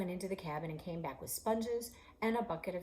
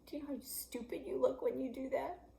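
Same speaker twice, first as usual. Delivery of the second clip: mocking laugh; 8 semitones higher than usual. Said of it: "and he laughed".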